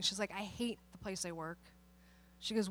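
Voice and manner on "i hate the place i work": much softer, sunken voice; retold despair. Teacher who had been crying, quietly despairing. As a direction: let it drop low and defeated. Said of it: "she was bawling".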